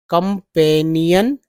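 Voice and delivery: Companion read slowly, syllable by syllable. Shown as a demonstration. Teacher reading slowly so students can follow. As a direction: draw out companion one piece at a time. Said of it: "देखिए कैसे पढ़ता हूँ".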